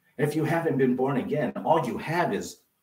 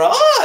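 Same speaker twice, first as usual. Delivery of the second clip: very high loud mock baby shriek; baby scream; 13 dB louder than usual. Preacher acts out the screaming baby, shrill yell. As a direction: shriek like a baby hit by cold water.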